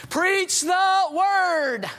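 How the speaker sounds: slow, drawn-out shouted proclamation; ringing shout. Acted. Paul proclaims the answer to the preacher, slow shout. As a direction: thunder it out slowly like an apostle. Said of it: "he would go into a glow and he would say".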